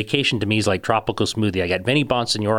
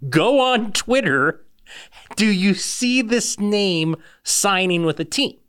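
imitating the friend, higher, slow and insistent; mock urgent friend; 8 semitones higher, much slower than usual. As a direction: play the friend pushing him to check Twitter, drawn out. Mimicking the friend's phone call.

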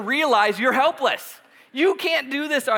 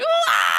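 mock kid scream; 13 semitones lower, 4 dB louder than usual. He's kicking and screaming.

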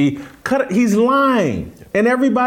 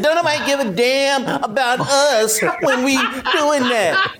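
laughing mock of the rant, higher; mocking his rant; 5 semitones higher than usual. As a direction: parrot him back with a laugh. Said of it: "surprised he didn't start bobbing his head".

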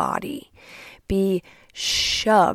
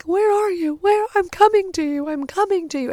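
very high-pitched mock panic; urgent, hammed-up motherly alarm; imitating frantic mother; 11 semitones higher, 3 dB louder than usual. Mother on the phone rushing to her daughter, mock panic.

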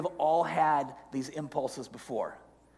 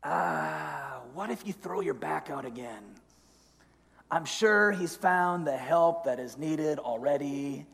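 devil on the shoulder. Acted. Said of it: "then the second voice comes in".

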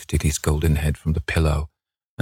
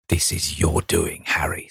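bitter. A narrator's reading. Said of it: "said the painter bitterly".

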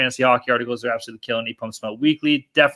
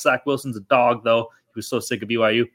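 ordinary talk

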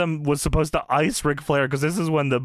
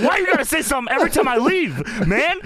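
whiny complaint; 8 semitones higher, faster than usual. Retold or imitated. Acted Kurt Angle complaining.

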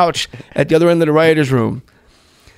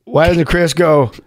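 ordinary delivery

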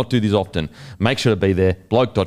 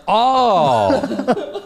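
laughing realisation; 13 semitones higher than usual. Retold or imitated. Realising the guy meant Guru.

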